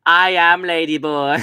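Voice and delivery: hammed-up imitation of a lady boy; mock lady boy voice. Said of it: "And she goes".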